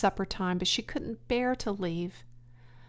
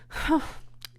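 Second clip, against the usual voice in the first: breathy unvoiced sigh; pig's sigh; 8 semitones higher than usual. Wilbur sighing after failing to spin, sigh. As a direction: let out a long sigh. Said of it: "Wilbur sighed".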